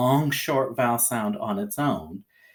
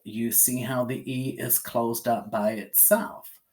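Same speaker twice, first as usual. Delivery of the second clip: usual speaking voice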